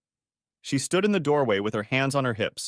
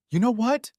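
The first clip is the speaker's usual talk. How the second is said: high, louder scream; 12 semitones higher, 4 dB louder than usual. Acted. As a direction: shriek it at him. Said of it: "she screamed".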